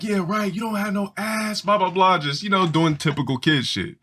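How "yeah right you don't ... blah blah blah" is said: put-on high dismissive kid taunt; a higher scoffing playground voice; mocking kid. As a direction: boys scoff at a girl. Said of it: "So we'd be like".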